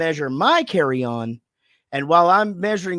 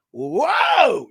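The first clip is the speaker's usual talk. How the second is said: startled shout; 4 semitones higher, 5 dB louder than usual. Retold or imitated. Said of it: "it just fucking kicks in".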